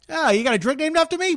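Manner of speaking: high-pitched joking radio host; car radio host. Acted. Car show host telling a caller a joke, high hammy voice. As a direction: play the jokey call-in car show host. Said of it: "I'll be click or clack".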